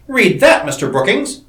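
gasped. A narrator's reading. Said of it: "he gasped".

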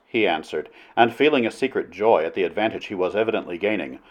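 plain reading voice

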